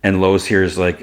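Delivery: ordinary delivery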